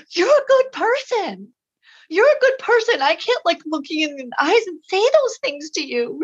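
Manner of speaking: imitating a girl overcome, very high-pitched; retold tearful sobbing. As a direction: insist through imitated tears. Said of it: "started streaming tears and sobbing".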